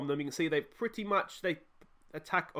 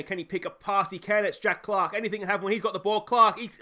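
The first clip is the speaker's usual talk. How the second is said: building louder and higher as the attack develops; rising excitement; 5 semitones higher, 6 dB louder than usual. Real, not acted. Commentating his own match as the attack builds, rising excitement. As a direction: voice climbing with the chance.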